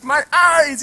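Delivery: high, loud mock cry; cute silly kid. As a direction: imitate a little boy hamming it up. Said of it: "He's being this cute little kid".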